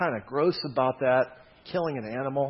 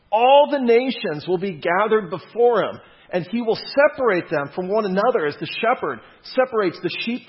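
loud, rising sermon reading; preaching scripture; 4 semitones higher, 5 dB louder than usual. Acted. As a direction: proclaim it from the pulpit. Reads Jesus' words with force.

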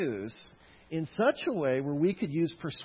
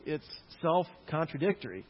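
usual speaking voice

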